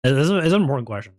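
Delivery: rapid mock-earnest delivery; fast mock serious. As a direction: rattle it off like serious business.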